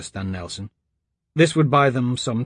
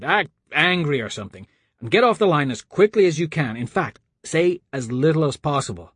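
higher, hurried character voice; urgent Lucas; 4 semitones higher than usual. A narrator's reading. Lucas coaches Sims before the call, urgent. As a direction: urge him on, no time to explain.

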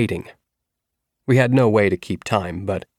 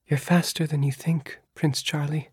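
higher, softer encouraging voice; soft encouraging girl; 7 semitones higher, 5 dB quieter than usual. A narrator's reading. Leah in his dream encouraging him, higher soft voice. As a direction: reassure him gently.

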